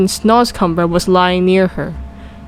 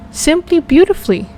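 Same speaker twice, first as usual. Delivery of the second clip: put-on higher, earnest girl's voice; Sophie insists; 8 semitones higher than usual. A narrator's reading. Sophie insists to the giant, higher earnest voice. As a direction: reassure him he speaks beautifully. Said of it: "Sophie repeated".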